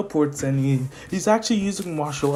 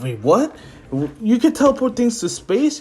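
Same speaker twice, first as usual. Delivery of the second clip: high, loud mock disbelief; acted astonishment; 8 semitones higher, 5 dB louder than usual. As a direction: blurt it out as a stunned friend. Friends stunned by teleport power.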